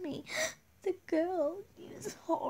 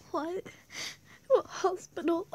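panicked cry; 5 semitones higher, 4 dB louder than usual. Acted. Hearing her friend is sick.

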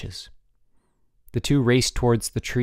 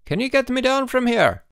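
cry for help; 13 semitones higher, 3 dB louder than usual. A narrator's reading. Stranded boy begging to get down, crying out. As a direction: call down high and pleading. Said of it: "it cried".

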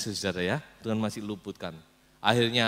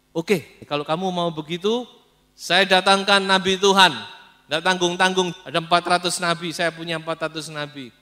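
boastful king; 6 semitones higher, 10 dB louder than usual. Acted. King Ahab boasts to Yosafat.

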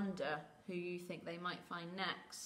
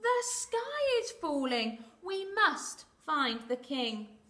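shouting; 7 semitones higher, 7 dB louder, slower than usual. Acted. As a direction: call it out urgently, stretching each phrase. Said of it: "Charged down the path shouting".

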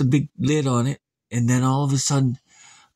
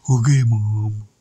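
lower, slow, louder oaf; dopey son; 5 semitones lower, 5 dB louder, slower than usual. Acted. Cletus answering his mom, low and slow. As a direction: answer like an obedient brute. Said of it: "She said".

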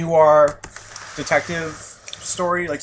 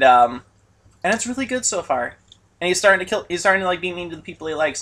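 usual speaking voice

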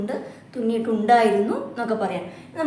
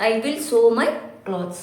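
slow example reading; much slower than usual. Shown as a demonstration.

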